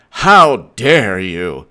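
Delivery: slow, low, put-on voice of the caught husband; indignant husband. Husband caught in bed turns on his wife, mock outraged and slow. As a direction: drag out how dare you like a man caught cheating. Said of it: "and he's like".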